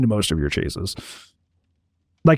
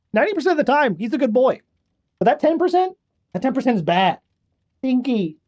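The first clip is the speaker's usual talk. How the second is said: high teasing pet voice; talking to the cat; 6 semitones higher than usual. Real, not acted. Owner teases his cat about being a menace, high and fond.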